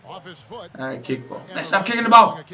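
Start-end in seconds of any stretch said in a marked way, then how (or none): 1.5-2.4 high, loud scolding of a player; exasperated call-out. Real, not acted. Gamer telling players to stop kicking, exasperated. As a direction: call out sharply to make them stop.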